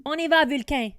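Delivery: loud, eager rallying call; shout. Acted. Rory launching her dragon into battle, loud cry. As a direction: fire up the dragon for takeoff. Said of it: "crie-t-elle".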